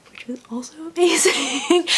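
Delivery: breathless whispered awe. Real, not acted. Whispering to viewers how amazing the interview was. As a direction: whisper it like a giddy secret. Aside about author interview.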